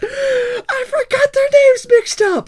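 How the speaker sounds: high, slow words squeezed through laughter; laughing. Real, not acted. Player cracking up after mixing up the girls' names. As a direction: laugh helplessly at the mix-up. Flustered after picking wrong girl.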